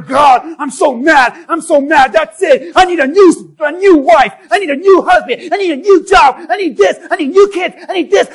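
loud, high, ranting complaints to God; hammed-up furious prayer; mock angry rant. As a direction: storm through the demands, half laughing. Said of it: "So we sit here and say".

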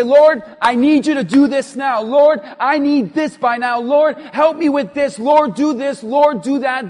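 loud list of orders to God; demanding mock prayer. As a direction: act out a bossy, insistent prayer. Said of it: "you just tell God what to do".

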